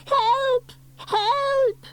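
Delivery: very high repeated plea; frightened cry. A narrator's reading. Boy waiting at window, high frightened cries. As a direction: call out in fright. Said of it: "cried Norman".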